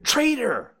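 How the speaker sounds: very high scream; piercing, high-pitched accusation; screaming squirrel. A narrator's reading. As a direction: scream it like a tiny furious animal.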